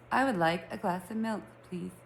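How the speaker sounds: "milk" with a careful short i; standard pronunciation. Shown as a demonstration. Practising like everyone else, carefully short-i "milk". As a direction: say "milk" to rhyme with "silk". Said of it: "say it like everyone else".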